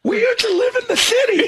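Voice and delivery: very high, mock-pitiful boy; Tyler. Co-host as stepson moved to the farm, very high mock whine. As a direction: squeak out a hammed-up complaint. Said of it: "He's having trouble adjusting".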